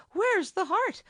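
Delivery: high, loud gasp of alarm; startled gasp at the brute's declaration. A narrator's reading. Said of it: "she gasped".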